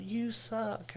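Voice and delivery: high heckler call, kept soft; small, high-pitched mock shout; mock heckler yell. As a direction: imitate a heckler's yell without the volume. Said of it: "she yells out".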